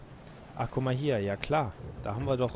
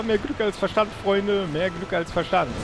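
higher, louder triumphant crowing; gleeful relief; 6 semitones higher, 5 dB louder than usual. Real, not acted. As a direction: crow about the lucky escape. Gloating after narrow escape.

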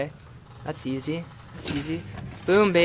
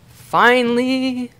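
drawn-out, higher, louder "Finally!"; relieved triumphant cry; 6 semitones higher, 11 dB louder, much slower than usual. Real, not acted. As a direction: stretch "Finally!" out in sheer relief.

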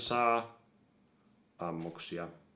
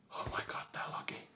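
whispered, much softer grumble; frustrated whisper; 10 dB quieter than usual. Real, not acted. Annoyed at the lagging game, frustrated whisper. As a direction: hiss at the lag.

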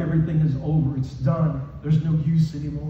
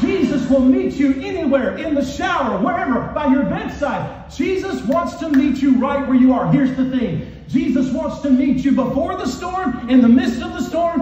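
high, forceful revival preaching; yelling preacher; 8 semitones higher, 4 dB louder than usual. Real, not acted. Preacher exhorting the church that Jesus meets them anywhere, yelling. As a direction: drive each phrase home loud and high. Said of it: "scream and yell at them like I'm doing".

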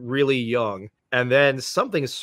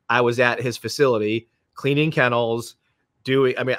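ordinary talk, nothing marked

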